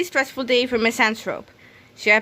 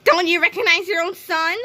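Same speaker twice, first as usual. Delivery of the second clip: higher, hurt plea; pleading son; 7 semitones higher than usual. A narrator's reading. Krupp begging his parents, higher and hurt. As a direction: plead to be recognized.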